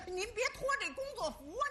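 normal talking voice

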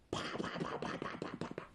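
quick whispered pa-pa-pa strikes; rapid slapping noises. A narrator's reading. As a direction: mimic a flurry of palm blows. Imitating flurry of palm strikes.

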